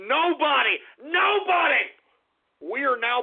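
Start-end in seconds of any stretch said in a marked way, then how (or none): 0.1-1.9 building fervour. Acted. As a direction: build from firm to rousing. Host rallying his listener army.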